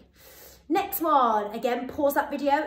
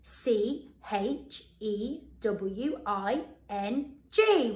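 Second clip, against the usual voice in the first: slow spelling; much slower than usual. Shown as a demonstration. Teacher spells the word for children, slowly. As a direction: spell chewing slowly, letter by letter. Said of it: "Let's see whether you were right".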